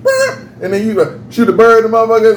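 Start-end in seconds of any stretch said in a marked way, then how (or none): 0.0-0.4 squeaky high burp of a horn; high comic honk sound; mock horn noise. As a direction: honk it like a tiny silly horn. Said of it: "and then, like".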